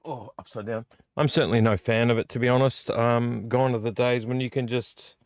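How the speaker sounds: normal talking voice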